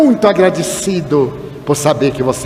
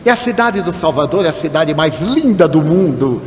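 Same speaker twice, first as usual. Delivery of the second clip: ordinary talk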